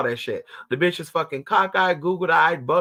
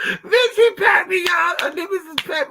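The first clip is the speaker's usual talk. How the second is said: much higher and louder, squealing; excited squeal; 6 dB louder than usual. Real, not acted. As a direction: squeal as the dog paws at you. Dog pawing her, squealing.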